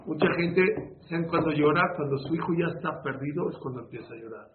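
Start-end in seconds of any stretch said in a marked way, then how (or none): none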